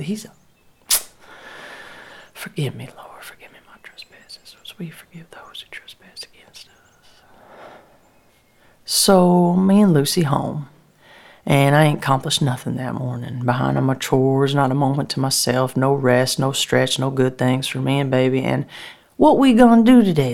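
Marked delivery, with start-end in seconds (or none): 2.3-7.2 much softer murmured prayer; whispered prayer. Acted. Mother asks the Lord's forgiveness, soft whispered prayer. 19.2-20.3 put-on high, loud little girl question; a child begging for plans; eager child. Daughter asks her mother about the day, high eager child.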